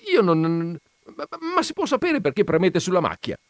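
high, fast stammering question; flustered stammer. Acted. The confused gentleman asking Veneranda, stammering high and fast. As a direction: stumble then rush the question. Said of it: "balbettò il signore".

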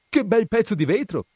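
put-on high, bright little girl's cry of joy; delighted exclamation. A narrator's reading. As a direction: marvel at the shiny glass. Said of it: "esclamò la bambina".

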